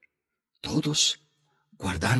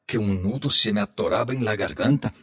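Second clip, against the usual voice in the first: plain reading voice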